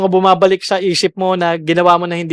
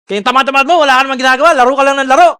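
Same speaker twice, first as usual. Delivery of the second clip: mock scolding; 5 semitones higher, 4 dB louder, much faster than usual. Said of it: "parang galit".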